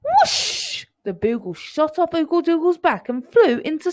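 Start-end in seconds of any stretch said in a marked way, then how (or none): 0.0-0.9 high, loud whoosh. A narrator's reading. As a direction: sweep it away fast. Boogle shoots off.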